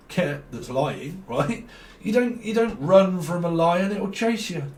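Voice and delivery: ordinary talk, nothing marked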